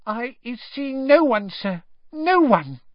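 high, slow squeaky elf voice; frightened elf. Acted. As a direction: deny it trembling.

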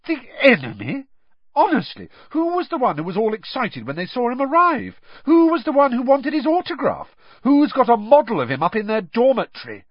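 indignant retort. A narrator's reading. Hermione mocking Ron's hero worship, indignant and loud. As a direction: throw his hypocrisy back at him. Hermione hits back at Ron.

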